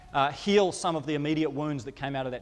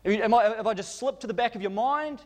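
higher, quicker, mock-baffled jab at God; sarcastic accusing; 7 semitones higher than usual. David accusing God sarcastically in his lament. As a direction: needle God as if He forgot you. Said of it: "This is almost sarcastic".

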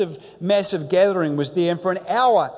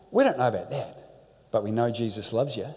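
smiling simplicity; 9 semitones lower, 4 dB quieter, faster than usual. Retold or imitated. Young women gently answer the arguing cult man, smiling simplicity. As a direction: lower and softer, gently smiling. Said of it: "with the most beautiful, smiling simplicity".

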